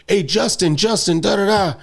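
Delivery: high-pitched imitation of someone calling; mock call for attention, pitched well up. Someone behind calls to a pop star, high mock call.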